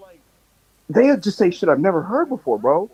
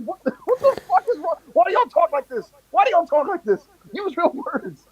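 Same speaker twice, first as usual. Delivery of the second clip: high-pitched ranting bafflement; fed-up, high-pitched scolding; exasperated outburst; 10 semitones higher than usual. Retold or imitated. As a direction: plead in comic exasperation, voice way up. Acting out exasperation at slang.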